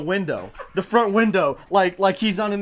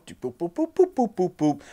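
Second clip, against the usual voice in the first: light, quick run of boops; phone dialing noises. Retold or imitated. Storyteller dialing his smartphone, sing-song boops. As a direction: mimic button beeps with the mouth.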